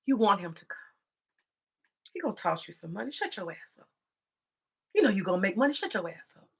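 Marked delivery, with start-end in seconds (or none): none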